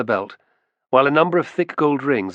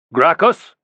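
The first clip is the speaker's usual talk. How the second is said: loud, higher commanding call; 6 semitones higher, 8 dB louder than usual. Acted. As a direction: call him to heel. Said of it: "There was no mistaking the tone of command".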